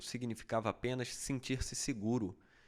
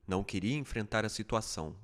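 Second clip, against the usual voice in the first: ordinary delivery, nothing marked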